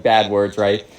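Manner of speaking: ordinary delivery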